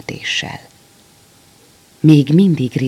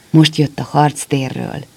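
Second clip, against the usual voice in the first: usual speaking voice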